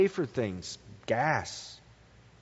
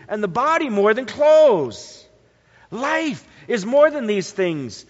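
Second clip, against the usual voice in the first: louder, lifted preaching of the verse; scripture reading; 4 semitones higher, 5 dB louder than usual. Acted. Preacher reading Luke to the congregation, proclaiming. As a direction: proclaim the promise to the flock. Reads Jesus's words with force.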